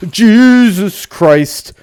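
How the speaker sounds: gasp, then a shocked, drawn-out cry; jolted by a jump scare, cry it out; startled gasp. Real, not acted. Sudden scare in horror game.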